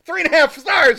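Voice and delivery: high, loud laughing disbelief; laughing outburst. Real, not acted. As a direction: cry out through laughter.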